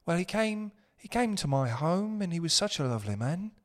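put-on light, soft British woman accent, pitched a bit up; a hint of a posh British lady; lightly voiced British woman accent. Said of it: "I don't think I can even really do it".

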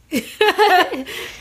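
high bright real laughter. Real, not acted. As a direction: laugh at the awkwardness. Laughing at the awkward ending.

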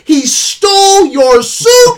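loud, high, drawn-out mock outrage; Nick Fury. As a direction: yell the accusation, hammed-up and slow. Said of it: "even Nick Fury calls him out".

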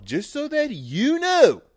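high, loud, drawn-out mock shout; mock yell. Podcaster repeating himself to listeners, high mock shout. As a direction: belt it out like a rant.

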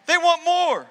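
rising emphasis on the echo. Acted. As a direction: push the repeat harder. Repeated for emphasis.

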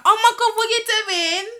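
high-pitched imitation of a startled coworker; mock shocked protest. Coworker whose headphone got yanked, shrill mock protest. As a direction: squeal it, mimicking her outrage.

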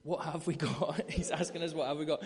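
talking through real laughter; laughing. Real, not acted. Preacher laughing while retelling Peter's errand, laughing. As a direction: laugh along as you tell it.

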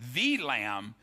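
THE said as a punched "thee" before lamb; "the" set apart and stressed, rhyming with "see". Real, not acted. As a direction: lean hard on the article "the", said "thee". Contrasts a lamb with the lamb.

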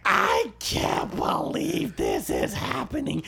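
slow, high, strained mock cry; mock agony. Man getting his ears bitten, hammed-up agonised cry. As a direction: draw it out, hamming up the helpless shock.

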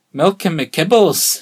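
loud, slow, exaggerated Scottish accent; mock Scottish accent. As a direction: draw it out in a silly Scottish accent. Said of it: "Well, they are Scottish".

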